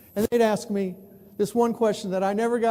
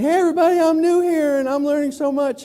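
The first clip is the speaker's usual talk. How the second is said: loud, high, cheery greeting; cheery newcomer; 6 semitones higher, 5 dB louder than usual. Retold or imitated. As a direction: bounce in like an eager new kid. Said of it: "I wrote a column saying".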